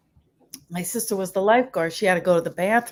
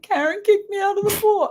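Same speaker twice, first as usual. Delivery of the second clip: high-pitched mock wail; imitated tearful whine to mom; crying brother; 12 semitones higher than usual. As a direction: wail it like a tattling kid. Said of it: "he's crying".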